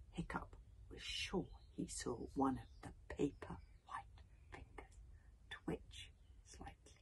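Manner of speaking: soft, breathy storytelling; hushed suspense narration. A narrator's reading. Narrator building suspense as the corpse's finger twitches, hushed. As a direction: draw out the suspense almost in a whisper.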